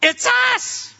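very high, loud squeaky falsetto; Elmo. Acted. Women answer the disciples at the door, Elmo-like. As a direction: squeal it in a silly puppet voice. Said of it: "That sounded like Elmo".

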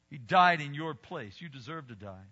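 normal talking voice

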